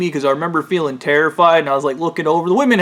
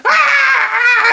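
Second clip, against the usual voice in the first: very high, long shriek; imitated scream; 4 dB louder than usual. Imitating Shay screaming in the ritual, high scream. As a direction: shriek it in mock terror. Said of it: "and then Shay's screaming".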